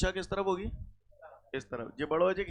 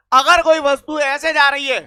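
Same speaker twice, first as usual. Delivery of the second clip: much louder, higher and drawn out; loud, slow call; 5 semitones higher, 14 dB louder, slower than usual. Real, not acted. Teacher putting a resultant-force question to students, loud slow call. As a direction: shout it slowly so the whole class hears.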